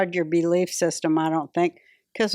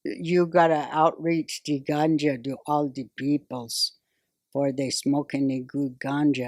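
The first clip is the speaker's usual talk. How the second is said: fake Jamaican accent; 6 semitones lower, 4 dB quieter than usual. Shown as a demonstration. Comic doing her fake Jamaican accent for her act bit. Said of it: "I tried to do the fake Jamaican accent".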